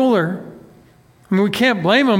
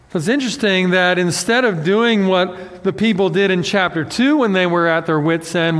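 normal talking voice

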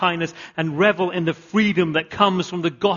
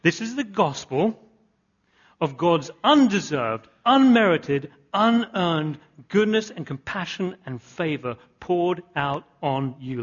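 slow preacher's underline; emphatic list; slower than usual. Shown as a demonstration. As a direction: hammer each word of the list. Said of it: "Let me just underline that again".

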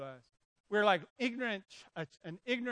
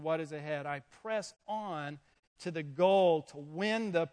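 scripture reading; 2 semitones lower, 4 dB louder than usual. Acted. Reads scripture aloud.

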